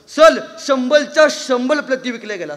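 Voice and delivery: loud, high boast with R as L in "शंभरच्या शंभर"; imitated speech defect, "शंभर" said "shambal"; lisping boy. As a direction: announce "प्रती" as "plati", L for R. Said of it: "ते बोबडं बोलणारं".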